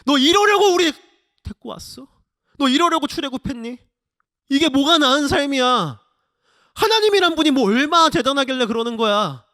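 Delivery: high, loud accusing outcry; angry resentful complaint. Acted. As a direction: confront the leader as a betrayed crowd. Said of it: "사람들이 이렇게 원망하는 거예요".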